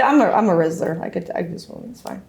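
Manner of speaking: ordinary talk, nothing marked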